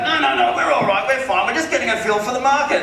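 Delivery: high, loud, affected rich man's voice; Philip Harding, wealthy caller. Retold or imitated. Homeowner brushes off the eager agent, high and affected. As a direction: wave off the offer as a mimicked wealthy man. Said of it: "wealthy people, like, they just have this".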